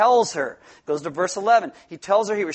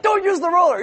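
high, loud mock warning; imitating his wife cutting in, high and loud; wife's sharp correction; 10 semitones higher, 5 dB louder than usual. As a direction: snap at him to drop the roller. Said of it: "She's like".

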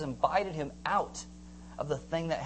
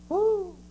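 high, loud "woo"; a short high whoop of daring; excited whoop; 6 dB louder than usual. Acted. Preacher acting Peter stepping out of the boat, excited whoop.

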